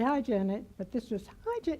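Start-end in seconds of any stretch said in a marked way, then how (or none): 1.5-1.8 much higher, gushing hello; imitating a friend's overjoyed high greeting; enthusiastic. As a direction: squeal the greeting with delight.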